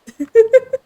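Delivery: shrieking laugh; loud high laugh. Real, not acted. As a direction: burst out laughing. Big laugh after dog bit.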